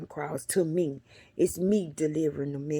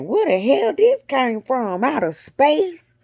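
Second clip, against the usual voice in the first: higher, louder amazed exclaim; 7 semitones higher, 7 dB louder, slower than usual. Retold or imitated. Seeing glittering orchids in the store, loud amazed wonder. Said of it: "I'm like".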